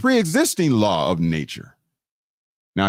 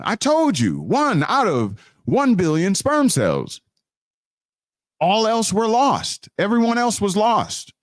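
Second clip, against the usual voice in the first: rising preacher's cadence, higher; preaching; 4 semitones higher than usual. Acted. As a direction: proclaim it to the congregation. Preaching to the congregation.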